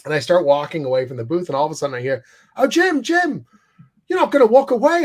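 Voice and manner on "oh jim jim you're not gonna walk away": high, excited call across a crowded aisle; Richard calling out. Retold or imitated. Richard hailing Jim as he walks away, high and excited. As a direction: put on a much higher, delighted voice to hail him.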